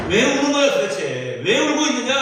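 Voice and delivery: Jesus challenging the weeping Mary, loud and sharp; pointed rebuke. Retold or imitated. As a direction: call her out sharply for crying. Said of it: "라고 말씀하시는 거예요".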